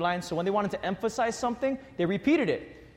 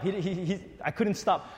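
ordinary talk, nothing marked

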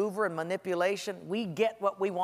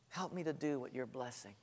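much softer, almost breathed; hushed prayer; 6 semitones lower, 13 dB quieter than usual. Shown as a demonstration. Preacher repeating the prayer to the congregation, hushed. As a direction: let the repeat fall to a hush. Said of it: "here's a better prayer".

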